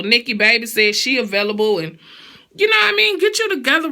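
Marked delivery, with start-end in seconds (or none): 2.5-3.9 high excited question. Real, not acted. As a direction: squeal it up high. Emphatic rhetorical question.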